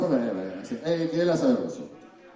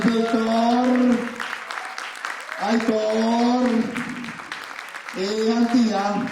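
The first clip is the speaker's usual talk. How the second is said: calling out names; 8 semitones higher, much slower than usual. Real, not acted.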